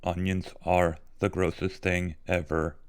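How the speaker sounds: slow, even robot monotone; spaced-out words on a level robotic pitch. Shown as a demonstration. Streamer redoing the line better for a viewer, in robot voice. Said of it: "I can do it better".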